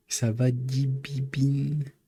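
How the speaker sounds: high, drawn-out cooing; playful baby talk. Pretend. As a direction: coo slowly to a little one. Playfully greeting imagined child of Didine.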